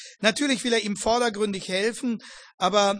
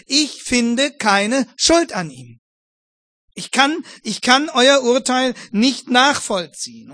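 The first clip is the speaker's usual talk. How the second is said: loud, slow, higher insistent protest; Pilate protesting; 4 semitones higher, 9 dB louder, slower than usual. Retold or imitated. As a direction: hammer each word at the crowd. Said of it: "jetzt sagt er es".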